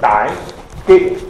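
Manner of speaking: "nein" punched high and loud, then "geht nicht" firmly; emphatic no. Shown as a demonstration. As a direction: hit "nein" hard and high. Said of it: "und Paulus betont den Nein". („nein“ stark betont, hoch und laut, dann „geht nicht“ bestimmt)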